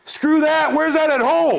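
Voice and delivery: very high, loud yelled objection; mock outrage. Real, not acted. Dad objecting to praise of kids' school behaviour, loud outrage.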